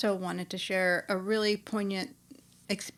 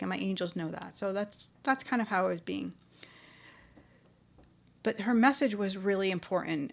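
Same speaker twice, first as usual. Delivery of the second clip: ordinary talk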